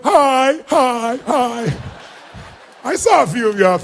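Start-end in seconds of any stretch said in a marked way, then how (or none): 0.0-1.7 eager greetings. Retold or imitated. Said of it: "You're like".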